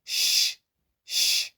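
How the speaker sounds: "sh" as a long, voiceless hush; English sh sound. Shown as a demonstration. Teacher demonstrating the S H digraph, "sh" as in English. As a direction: hold a clean hushing "sh". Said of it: "It gives us this sound".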